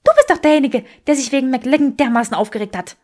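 woman turning the blame back on him; angry Granger. Acted. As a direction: jab the word du at him.

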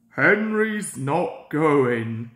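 slow, blunt and rude refusal. Acted. Gordon refusing Sir Topham Hatt, slow and rude. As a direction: drag it out like a stubborn engine. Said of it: "said Gordon rudely".

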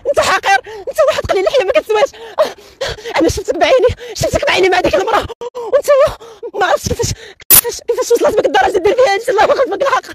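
high accusing woman's voice; betrayed wife. Acted. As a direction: scold him high as a cheated wife. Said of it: "غنجسد شي دور ديال شي وحدة، خانها راجلها".

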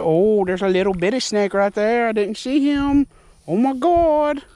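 high, loud startled cry; real fright at a little snake by his feet. Real, not acted. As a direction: jump at a sudden snake.

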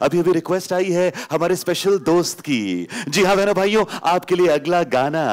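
lively mock DJ patter; radio disc jockey. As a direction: announce the next song like a disc jockey. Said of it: "suddenly we turn and say".

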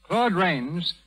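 ordinary delivery

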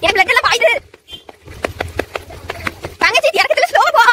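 loud calls from a moving bike; shouting while riding. Real, not acted. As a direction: call out loudly over the road. Calling out while riding bikes.

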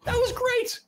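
high, delighted squeal of approval; excited praise. Real, not acted. Host applauds co-host's accent, thrilled. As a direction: gush with pitch shooting right up.

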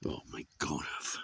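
ordinary delivery

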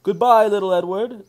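Gordon calling goodbye. A narrator's reading. Big engine calls goodbye to Edward, high and loud. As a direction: shout it back as you pull away. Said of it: "said Gordon as he puffed away".

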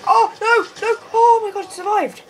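high, loud cry of dismay; dismayed outcry. Real, not acted. Gamer crying out as the enemy survives. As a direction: wail it out in disbelief.

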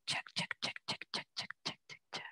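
soft, even whispered chant on the out-breath; rhythmic whispered count. Real, not acted. Coach pacing the class's exhale, whispered rhythmic count.

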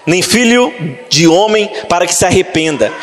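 usual speaking voice

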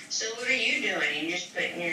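ordinary delivery

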